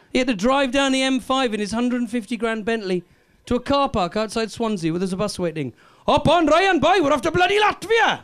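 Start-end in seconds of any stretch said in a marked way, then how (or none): 6.1-8.2 high, loud cheery Welsh call; bus driver hollering. Retold or imitated. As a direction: shout it across a car park to a star. Welsh team bus driver calling.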